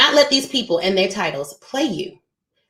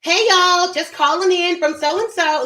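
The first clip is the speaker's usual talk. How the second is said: playful mock Southern phone greeting; Southern accent; 8 semitones higher than usual. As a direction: greet like a Georgia caller, lightly Southern. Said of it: "if I'm calling Georgia".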